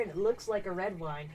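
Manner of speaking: ordinary delivery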